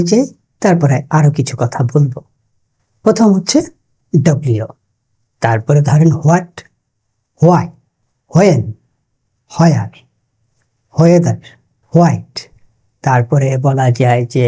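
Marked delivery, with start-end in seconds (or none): 4.2-4.7 ডব্লিউ said as "dob-liu"; local mispronunciation. Shown as a demonstration. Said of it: "সবগুলোর উচ্চারণ ভুল". 6.1-6.5 হোয়াট with a breathed h, "hwat"; local mispronunciation. Shown as a demonstration. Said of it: "সবগুলোর উচ্চারণ ভুল". 7.4-12.3 হোয়াই, হোয়েন, হোয়ার, হোয়েদার, হোয়াইট each with "hw"; local mispronunciation. Shown as a demonstration. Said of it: "সবগুলোর উচ্চারণ ভুল".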